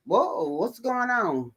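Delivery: high, slow mock surprise; puzzled whoa. The rider coming upon the scene, mild mock surprise. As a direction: sound lightly baffled. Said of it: "he was like".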